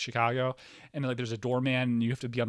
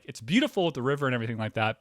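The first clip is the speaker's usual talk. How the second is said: ordinary delivery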